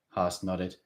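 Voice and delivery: usual speaking voice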